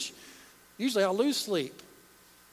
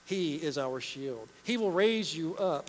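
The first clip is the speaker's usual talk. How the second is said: ordinary delivery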